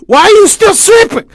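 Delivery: very high, loud shriek; shrieking mother. Acted. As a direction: shriek like a furious mother waking her son. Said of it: "she shrieked".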